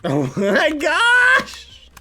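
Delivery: frustrated shouting. Real, not acted. Streamer losing a jump, high anguished shouting. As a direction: wail at the game in despair. Raging at hard game level.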